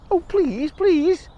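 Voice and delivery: high, begging; high pleading bird. Acted. Bird begs the mouse to ride, high pleading.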